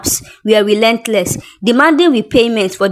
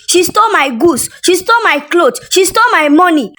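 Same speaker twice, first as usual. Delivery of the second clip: furious accusation; 7 semitones higher than usual. Acted. Brand owner shouting at Tasha in the market, furious. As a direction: tear into her in public, furious. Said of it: "angry brand owner confronting Tasha".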